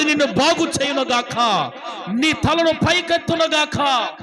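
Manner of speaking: high, loud, building blessing with shouts; preaching at full force. Acted. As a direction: raise the blessing to a shouted climax.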